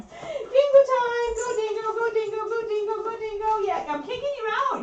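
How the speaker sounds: very high pet-talk chant; high, silly dog-owner singsong; playful puppy talk. Real, not acted.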